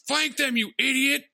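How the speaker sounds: high imitated officer barking orders; mock officer yell. Voicing the ranked stormtrooper yelling at subordinates, high mock yell. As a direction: yell at the troops like an angry squad leader.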